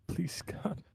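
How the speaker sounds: breathy whispered prayer for luck; whispered plea. Real, not acted. Player hoping for a good roll, whispers a plea. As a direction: whisper it as if begging the dice. Praying over a dice roll.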